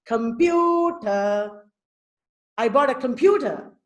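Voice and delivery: "computer" with "pu" lifted. Shown as a demonstration. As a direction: demonstrate stress by raising the middle of "computer". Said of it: "pew has a higher pitch".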